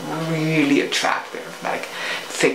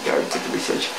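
whispered aside; 6 dB quieter, much faster than usual. Retold or imitated. Muttered reply to salesman.

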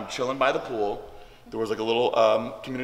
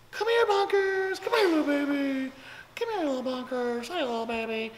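put-on very high cooing baby talk to a cat; P J Brown voice. Coaxing a cat stuck in a tree, very high baby talk. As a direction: coax the cat down in a very high sweet voice. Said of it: "the P J Brown voice".

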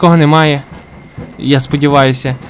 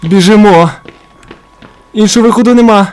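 louder, higher frantic call to run; panicked urging; 5 semitones higher, 5 dB louder than usual. Real, not acted. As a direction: rush the words out in panic. Running from old man.

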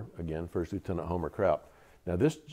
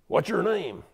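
put-on high, barked Southern-accented officer's question; strong Southern accent; 11 semitones higher, 4 dB louder, slower than usual. Battalion commander to new lieutenants, strong Southern accent. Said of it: "in a real strong Southern accent".

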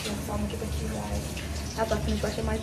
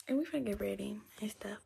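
ordinary talk, nothing marked

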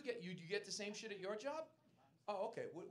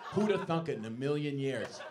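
mock surprise; 2 semitones lower, 8 dB louder than usual.

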